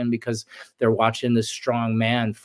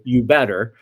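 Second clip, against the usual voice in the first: stern you better; 6 dB louder than usual. Retold or imitated. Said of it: "It didn't say".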